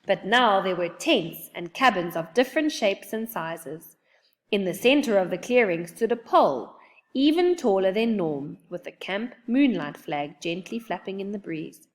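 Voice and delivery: ordinary delivery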